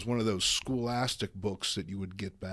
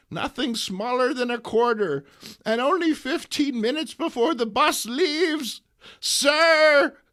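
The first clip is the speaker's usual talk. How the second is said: put-on much higher, louder frantic old woman; flustered old lady calling; 13 semitones higher, 12 dB louder than usual. Aunt May hailing a passing stranger, high loud fluster. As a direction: call out to him shrill and fussed.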